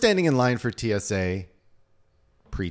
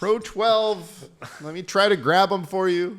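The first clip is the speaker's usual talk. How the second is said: raised, higher voice, as if across rows; calling out; 9 semitones higher, 4 dB louder than usual. Retold or imitated. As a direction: call helpfully down the plane. Calls to owner down the plane.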